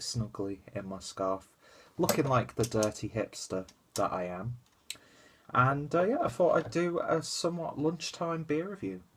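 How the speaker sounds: ordinary delivery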